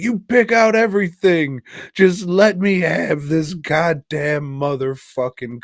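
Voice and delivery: retold husband's outburst, high and loud at first; acted-out protest, starts loud and high, then eases down; exasperated outburst. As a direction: play a fed-up husband arguing back. Acted couple's quarrel over table.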